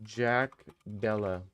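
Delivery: ordinary talk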